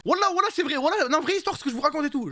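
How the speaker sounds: voix très haute, insistante; insistance excitée. Real, not acted. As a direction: jure que c'est vrai, tout excité. Insiste que l'histoire est vraie. (insiste avec excitation, très aigu)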